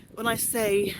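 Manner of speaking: ordinary talk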